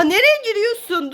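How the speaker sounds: ordinary talk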